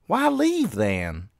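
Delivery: put-on high-pitched challenging girl; sharp high question from a sister; demanding. A narrator's reading. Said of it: "his sister demanded".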